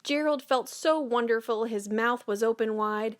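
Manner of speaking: plain reading voice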